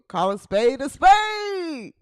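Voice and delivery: loud, drawn-out catchphrase shout; imitating his catchphrase. Host imitating a fellow show's host, shouted catchphrase. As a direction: call it out slowly, stretching the final word. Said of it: "He said it like that".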